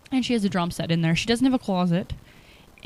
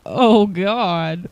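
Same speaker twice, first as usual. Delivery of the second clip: startled, louder groan of dread; alarmed "oh God"; 6 dB louder than usual. Retold or imitated. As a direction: react like you just read bad news. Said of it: "And I'm like".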